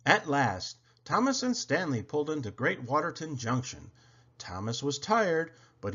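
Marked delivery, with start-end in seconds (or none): none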